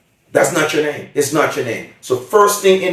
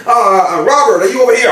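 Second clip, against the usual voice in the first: higher, louder call for Robert; 6 semitones higher, 4 dB louder, faster than usual. Acted. Gift giver calling across the room for Robert. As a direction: shout the name across the room.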